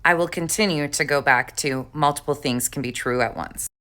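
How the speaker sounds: ordinary talk, nothing marked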